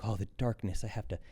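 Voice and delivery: hammed-up new age angst; mock caught-up seeker. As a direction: overdo the dread, poking fun at it. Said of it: "people get very caught up in".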